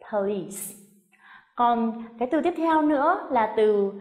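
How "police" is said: "police" as puh-LEESE, falling; stress on second syllable. Shown as a demonstration. Teacher showing where the stress goes, "police" stressed on its end. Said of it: "mình sẽ nhấn trọng âm".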